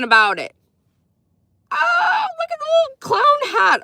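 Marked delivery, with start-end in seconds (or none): 1.7-3.8 squealing delight. Real, not acted. Reacting to chat emoji.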